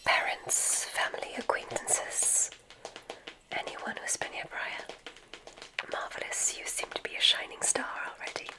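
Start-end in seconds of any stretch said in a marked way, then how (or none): none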